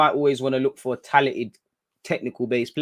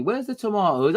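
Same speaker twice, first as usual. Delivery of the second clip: higher put-on posh English accent; mock posh English accent; 7 semitones higher than usual. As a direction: ask it in a fancy English accent. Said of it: "I'll be acting".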